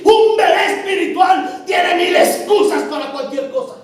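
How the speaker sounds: loud, forceful preaching; fiery preaching. Acted. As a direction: preach it hard to the congregation. (predicación enérgica y a gritos)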